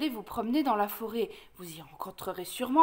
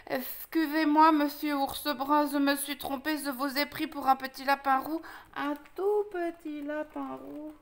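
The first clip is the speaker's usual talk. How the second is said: sheepish lisping apology. Acted. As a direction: apologise meekly to someone much bigger. Lisping wolf apologises to huge bear. (excuses penaudes du loup qui zozote)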